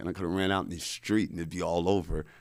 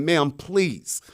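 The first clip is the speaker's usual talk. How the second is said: raised, urgent pleading; retold plea, high and insistent; begging; 6 semitones higher, 5 dB louder than usual. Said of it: "I'm begging the lady".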